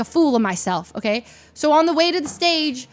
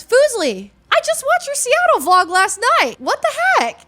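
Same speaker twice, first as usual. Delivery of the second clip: high excited voice of a fan; opponent's voice; 12 semitones higher, 4 dB louder than usual. Retold or imitated. Opponent teasing her at the table, high and excited. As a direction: chirp it high like an eager opponent.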